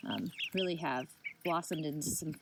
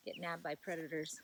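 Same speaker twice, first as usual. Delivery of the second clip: ordinary talk, nothing marked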